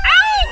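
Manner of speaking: high sudden ow; sharp yelp of pain. Real, not acted. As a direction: yelp as the shock hits. Hit by electric shock.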